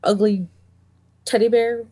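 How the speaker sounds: ordinary delivery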